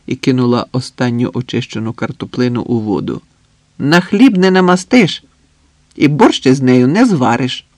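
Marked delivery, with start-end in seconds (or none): 3.8-7.7 loud, emphatic know-it-all; knowing lecture to a friend; worldly-wise expert. A narrator's reading. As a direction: deliver it as seasoned wisdom. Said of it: "з виглядом бувалого знавця".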